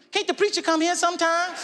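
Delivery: put-on high, pleading child voice; whining kid. Child begging mama to skip church, high and whiny. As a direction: whine it up high.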